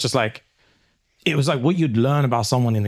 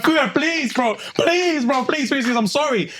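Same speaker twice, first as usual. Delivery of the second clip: mock crying plea; 13 semitones higher, slower than usual. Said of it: "I started crying".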